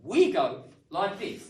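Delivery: showing how native speakers' rhythm goes; demo of English rhythm. Shown as a demonstration. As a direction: tap out our stress-timed beat. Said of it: "we don't talk like that".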